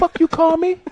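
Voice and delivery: high-pitched indignant comeback; mock outrage. Acting out the insulted man in a stand-up bit, mock outrage. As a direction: squawk it as an offended mock retort. Acted offended reply in retold bit.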